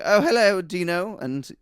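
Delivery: high, loud plummy greeting; mock upper-class butler; posh butler. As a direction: gush to the dog, sniff at the walker.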